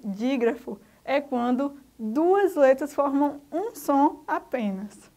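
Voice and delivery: slow emphatic definition. Shown as a demonstration. Teacher stresses the key definition.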